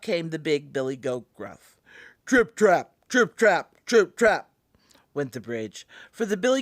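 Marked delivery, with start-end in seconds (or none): 2.3-4.5 loud, punched hoof beats; big heavy trip-trap. A narrator's reading. Narrator sounds the big goat crossing, loud and heavy. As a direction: stamp out each word like heavy hooves.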